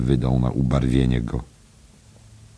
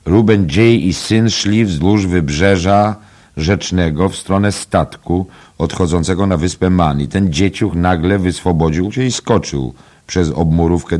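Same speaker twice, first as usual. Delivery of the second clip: loud, raised voice taking over the story; rude interruption; 6 semitones higher, 7 dB louder than usual. A narrator's reading. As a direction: cut in and tell it forcefully. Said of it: "przerwał mu niegrzecznie". (niegrzeczne, głośne wejście w słowo)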